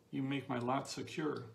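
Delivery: ordinary talk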